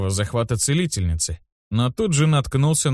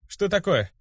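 put-on higher-pitched, guarded boy's question; mildly wary; 8 semitones higher than usual. A narrator's reading. Boy watches the puzzled healer, wary higher voice. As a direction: ask with a touch of alarm. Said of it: "спросил насторожившийся я".

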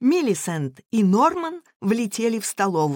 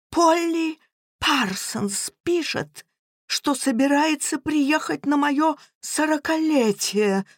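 groaning; 7 semitones higher than usual. Acted. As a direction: moan the bad news. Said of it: "простонал Эдвард".

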